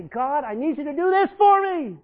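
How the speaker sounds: a believer loudly demanding that God act; demanding prayer. Retold or imitated. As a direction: nag God like a spoiled child. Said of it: "we always say".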